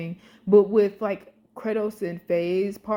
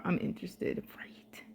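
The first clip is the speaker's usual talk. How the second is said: breathy unvoiced whisper; whispered aside; 3 semitones lower, 12 dB quieter than usual. Real, not acted. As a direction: drop to a secret whisper.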